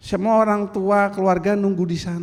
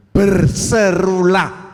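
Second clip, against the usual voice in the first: loud, slow cry to call out; 3 semitones higher, 9 dB louder, much slower than usual. Retold or imitated. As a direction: stretch it out as a loud summons. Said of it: "tadi saya mengatakan".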